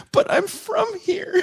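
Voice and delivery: high, drawn-out plaintive imitation; mock protest. Host mimics woman protesting to interrogator, high and plaintive. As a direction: squeal it as a wronged woman, laughing.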